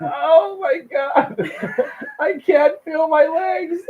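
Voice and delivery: very high, slow crying howl; hammed-up injured victim in agony; mock agonized wail. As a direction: stretch it into a high mock sob. Injured victim in retold prank call.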